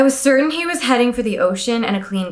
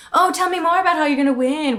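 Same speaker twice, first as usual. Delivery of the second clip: mock flattery; 5 semitones higher than usual. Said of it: "So then you could be like".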